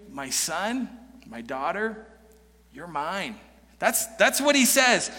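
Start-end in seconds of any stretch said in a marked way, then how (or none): 0.1-3.4 a father soothing his angry son; tender father. Retold or imitated. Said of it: "starts speaking tenderly".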